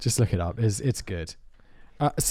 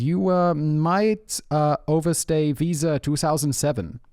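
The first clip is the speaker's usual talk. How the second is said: put-on broken English in a vague foreign accent, a little higher; generic foreign accent; 4 semitones higher, slower than usual. Prank caller as immigration officer to friend, generically foreign accent. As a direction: ham up a not-brilliant generically foreign accent. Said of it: "I'm sorry for my accent".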